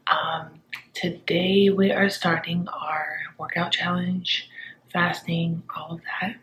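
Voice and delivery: soft hushed morning voice; quiet at night. Real, not acted. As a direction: keep it down so no one wakes. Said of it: "I'm trying to be quiet because my husband is sleeping".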